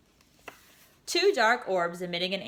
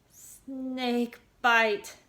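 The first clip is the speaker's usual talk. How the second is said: tight gulped words, higher; 5 semitones higher than usual. A narrator's reading. Girl telling the device, gulping in fear. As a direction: gulp it out afraid. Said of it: "Ava said with a gulp".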